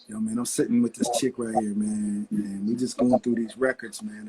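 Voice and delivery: a hint of the producer's voice, relaxed on the phone. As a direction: drop the voice a bit, cool and casual. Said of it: "that [name] sounding voice".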